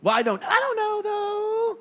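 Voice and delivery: very high, loud, drawn-out LA upspeak; mock LA uncertainty, sailing way up; LA talk. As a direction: put on a squeaky LA accent. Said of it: "L A talk".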